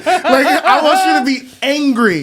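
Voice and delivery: ordinary talk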